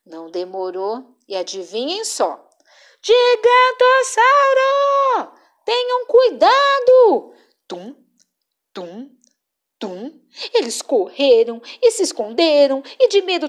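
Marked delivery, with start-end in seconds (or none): 3.0-7.3 high, loud drawn-out warning cry; shouting alarm. Acted. Cabeçudo falsely warning friends, high loud shout. 7.7-10.1 very soft footstep sounds; soft distant thuds. A narrator's reading. Narrator making faraway footsteps, very soft.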